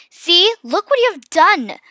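high, loud, slow reproach; accusing. A narrator's reading. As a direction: throw the blame at your sister. Accusing sister after the loss.

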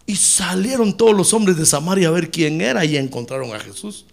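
ordinary talk, nothing marked

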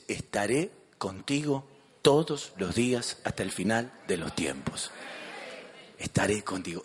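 low, hushed, unhurried comfort; tender reassurance. Real, not acted. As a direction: let the promise settle gently, with pauses. Preacher comforts with a promise. (consuelo tierno, en voz baja y pausada)

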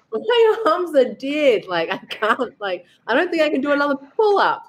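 gasping, spent after the workout; out of breath. Retold or imitated. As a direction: puff the words out, exhausted. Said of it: "I'm so out of breath".

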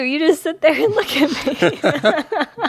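high-pitched laughter through the words; laughing while talking. Real, not acted. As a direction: laugh helplessly as you complain. Venting while laughing at husband.

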